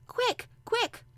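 very high, loud hurrying cry; urgent, excited boy's squeal. A narrator's reading. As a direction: hurry him along breathless with eagerness. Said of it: "digging excitedly".

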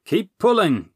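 loud, high urgent call; crying out. Acted. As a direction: shout encouragement over the strain. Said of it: "he cried".

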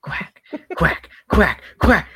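high mock quacking; duck quacks. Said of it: "my friend just started going".

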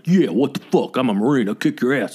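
imitated belligerent drunk; mock tough-guy slurred threat; aggressive drunk Marine. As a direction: bluster like a drunk Marine itching for trouble. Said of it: "he was being aggressive".

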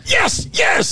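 high cheering yes yes; excited fan cheering at the TV; cheering on curling. Retold or imitated. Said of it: "finding myself going".

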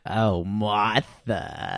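put-on low, very slow old man voice; old man Harold. [name] answering as old husband Harold, slow low old-man voice. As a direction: drag it out like a tired old husband.

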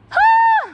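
real exasperated sigh; heavy sigh. Real, not acted. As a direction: let out a big puff of breath. Exasperated at the early sunrise.

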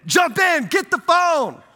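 high, loud mock yell from the riverbank; hammed-up frantic yell. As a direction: shout it like friends egging him on. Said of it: "they're yelling".

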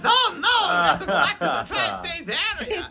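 high, loud cartoon villain in a panic; Doofenshmirtz. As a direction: exclaim it as a hammy evil scientist. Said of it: "sometimes he forgets that he's Doofenshmirtz".

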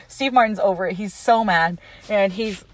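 usual speaking voice

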